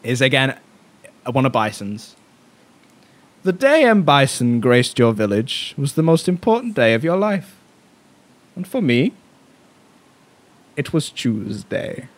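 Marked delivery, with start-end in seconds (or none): 3.4-12.1 Bison impression. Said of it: "which I will attempt to replicate".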